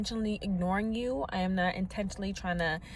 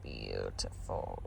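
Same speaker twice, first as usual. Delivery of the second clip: mock deep voice; 11 dB quieter, much slower than usual. Admiring her breakfast bowl.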